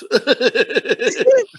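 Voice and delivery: high burst of laughter; cracking up at his own joke. Real, not acted. Friend laughing at his own bit, high laugh.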